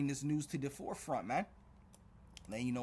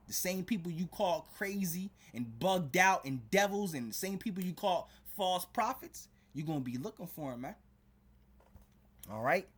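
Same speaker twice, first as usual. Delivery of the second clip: louder, higher fiery sermon; preaching at full force; 6 semitones higher, 4 dB louder than usual. Acted. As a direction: drive the warning home from the pulpit. Preacher warns the listeners.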